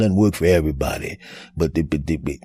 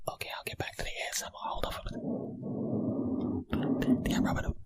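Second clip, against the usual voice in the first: ASMR whisper demo; 15 dB quieter, faster than usual. Shown as a demonstration. Podcast host showing his partner ASMR, whispering. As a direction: whisper right into the mic like an ASMR video. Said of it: "talking about that A S M R shit".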